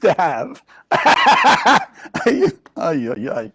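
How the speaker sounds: words breaking into self-mocking laughter; rueful laughing. Real, not acted. Rueful laughing while confessing own guilt.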